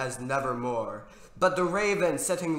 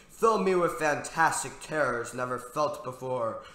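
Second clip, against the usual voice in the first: usual speaking voice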